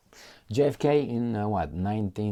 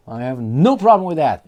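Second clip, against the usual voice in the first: louder, emphatic mock retort; loud mock take; 6 semitones higher, 11 dB louder than usual. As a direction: belt it out, hamming the insistence. Repeats line mocking.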